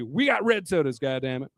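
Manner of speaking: usual speaking voice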